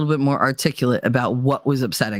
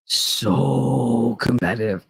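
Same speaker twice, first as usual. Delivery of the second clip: slow, lower So competitive, stretched; drawn-out repeat; 6 semitones lower, much slower than usual. Real, not acted. Repeating her confession to listeners: slow drawn-out. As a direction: savor the admission slowly. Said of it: "I'm so competitive".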